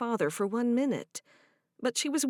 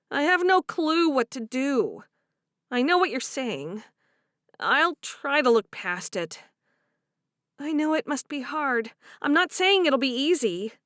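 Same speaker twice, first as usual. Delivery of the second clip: higher and louder, complaining; frustrated young wife; 5 semitones higher, 5 dB louder than usual. Acted. Favor complaining about Harriet, higher and aggrieved. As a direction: vent about the mother-in-law. Voiced character dialogue.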